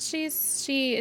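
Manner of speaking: usual speaking voice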